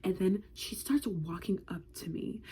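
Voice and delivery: scared hushed whisper; whispering in dread. Retold or imitated. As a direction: breathe it out in panic.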